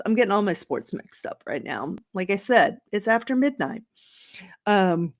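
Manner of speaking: ordinary talk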